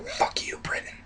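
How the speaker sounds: barely audible sneaky whisper; quiet defiance. Acted. Colonists answering Britain behind its back, very soft whisper. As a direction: whisper the insult so Britain won't hear. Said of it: "they were quiet about it".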